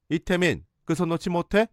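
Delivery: mildly voiced shouting mother; mock yell. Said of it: "소리 질렀고".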